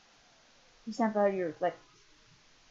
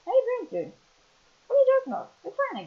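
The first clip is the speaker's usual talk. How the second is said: squeaky high voice, louder; high cartoon voice; 13 semitones higher, 4 dB louder, faster than usual. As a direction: play the friend high and loud. Voicing Andrew, high and loud.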